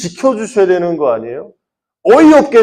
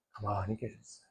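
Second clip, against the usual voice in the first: much softer and lower, almost breathed; near whisper; 10 semitones lower, 26 dB quieter than usual. Acted. Preacher ending the disciples' story to youth, near whisper. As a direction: barely voice it, drawing them in.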